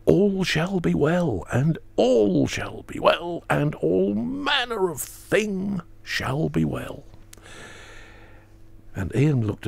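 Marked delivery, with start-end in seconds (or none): 0.0-7.0 put-on high, slow, reverent chant of old words; quaint solemn recitation. Juliana telling the nuns about heaven, slow and reverent. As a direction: intone it like a medieval saint's vision. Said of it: "in the rather quaint prose of the fourteen hundreds".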